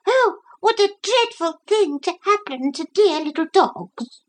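high, whining voice. A narrator's reading. Brenda the dog complaining in the cabin, high whine. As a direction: whine like a fretful little dog. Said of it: "she kept saying in a whining voice".

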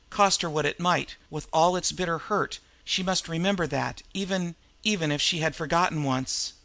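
ordinary delivery

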